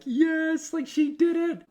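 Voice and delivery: high squeal of joy; excited cheer. Retold or imitated. Coach retelling his joy at her finish, squealing high. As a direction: squeal it like a proud friend. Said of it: "I'm like".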